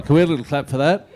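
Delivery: fast, high call for applause; rally the crowd for a clap; excited. Real, not acted. Host asks audience for applause.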